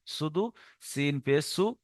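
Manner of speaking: soft, quick spelling slipped in; mimicking a student's muddled drill; students' wrong method. Shown as a demonstration. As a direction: toss off the spelling without stretching সিন. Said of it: "এটা একটা রং পদ্ধতি".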